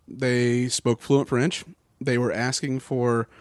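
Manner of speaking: ordinary delivery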